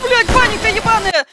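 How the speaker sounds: high, loud frantic cursing; panicked yell. Real, not acted. Panic after jump scare.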